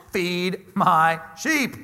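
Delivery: each word laid down very slowly; measured Jesus voice, every word stretched; slow, weighty command. Acted. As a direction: say it slowly and deliberately, like a gentle command. Jesus' reply to Peter.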